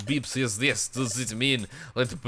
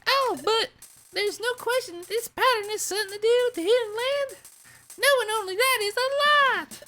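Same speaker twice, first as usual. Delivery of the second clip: high, fast head voice. Acted. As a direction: squeeze it up into a head voice. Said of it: "I'm doing so many head voices".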